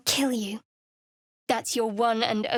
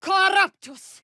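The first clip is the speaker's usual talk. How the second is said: higher, slow, forceful casting chant; spell incantation; 8 semitones higher, slower than usual. Acted. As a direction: call out the spell like a battle cry.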